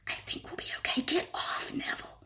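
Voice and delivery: soft voiceless whisper. A narrator's reading. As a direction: keep it hushed. Hiding behind door, whispering on.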